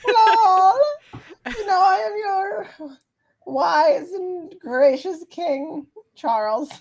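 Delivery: high, grand royal greeting; pompous king. Acted. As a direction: greet them like a vain king. Player voices the king again.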